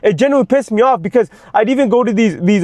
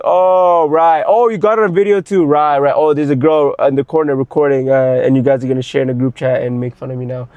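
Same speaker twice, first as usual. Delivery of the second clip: sarcastic resignation; 6 semitones lower than usual. Retold or imitated. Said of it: "I'm like".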